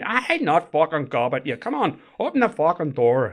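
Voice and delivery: irritated Northern Irish shout through the door; Northern Irish accent, insisting. Retold or imitated. Prisoner in Northern Irish accent insists he won't spit. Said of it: "like this".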